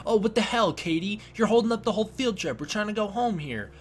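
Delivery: high, whiny mock friend complaining; hammed-up impatient friends griping; mock whiny annoyance. Reviewer mocks callous friends texting missing Katie, high whiny.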